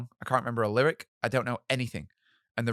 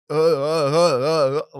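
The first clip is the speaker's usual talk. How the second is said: trembling loud stammered uh; physically shaking, voice juddering; shaking with nerves; 5 semitones higher, 11 dB louder, slower than usual. Shown as a demonstration. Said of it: "like this".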